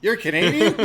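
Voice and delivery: high, loud hammed-up gasp of discovery; playfully exaggerated surprise; mock shock. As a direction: act stunned, pitched high and loud. Acted shock at a Canadian.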